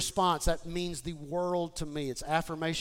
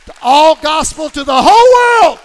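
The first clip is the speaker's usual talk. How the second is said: climactic preacher shout; 12 semitones higher, 26 dB louder than usual. Real, not acted. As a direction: shout it to the rafters as a rallying cry. Preacher's climactic rallying cry.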